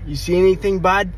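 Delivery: high, loud Boston construction guy; thick Boston accent. Retold or imitated. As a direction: imitate a Boston local calling out a question. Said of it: "thick as Bo-, Boston accent".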